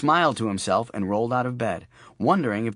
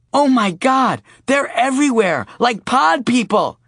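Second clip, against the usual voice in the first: put-on high, loud little girl panic; a child squealing in mock horror; alarmed little girl; 14 semitones higher, 8 dB louder, slower than usual. Daughter panicking about the Goyim, loud little girl voice.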